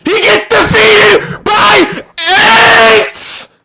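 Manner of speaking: slow, drawn-out furious yell; dragging each word out in outrage; outraged shout. Real, not acted. Outraged at the plot.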